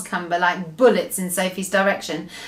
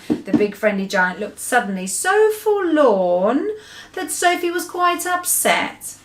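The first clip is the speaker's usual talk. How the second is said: ordinary delivery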